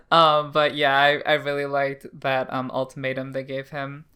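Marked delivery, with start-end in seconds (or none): none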